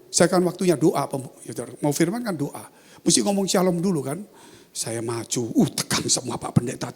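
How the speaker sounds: usual speaking voice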